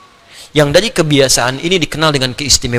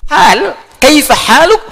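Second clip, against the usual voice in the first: higher, slow formal Arabic; classical Arabic; 9 semitones higher, much slower than usual. Shown as a demonstration. Teacher shows the proper classical form, high and slow. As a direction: intone kaifa haluk in classical style.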